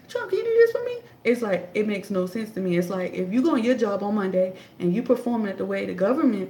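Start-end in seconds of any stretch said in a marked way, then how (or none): 0.1-1.0 high, whiny begging to Trump; mock pleading voter. Mocking voters begging Trump for help, high and whiny. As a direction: mimic a needy voter begging. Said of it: "but they say".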